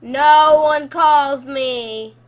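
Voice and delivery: loud outcry. Real, not acted.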